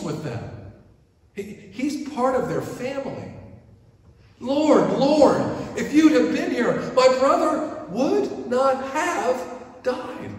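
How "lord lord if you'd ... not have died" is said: high, loud cry of Lord, slowing on the last words; Lord, why weren't you here, wailed high; anguished pleading. Retold or imitated. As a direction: plead like a grieving sister. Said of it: "Martha and Mary both plead to him".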